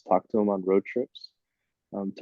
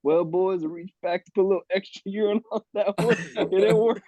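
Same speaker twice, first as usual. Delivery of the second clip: put-on country accent; 9 semitones higher than usual. Teammate imitates Morgan's Southern country accent in the dugout. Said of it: "in his country accent".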